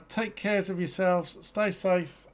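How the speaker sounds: ordinary talk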